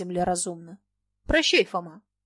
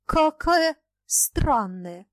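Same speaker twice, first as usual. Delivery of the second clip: high, very slow exclamation of wonder; drawn-out amazement, pitched up; astonished; 6 semitones higher, 4 dB louder, much slower than usual. A narrator's reading. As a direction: marvel at it, stretching each word. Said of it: "удивлённо воскликнула Люба".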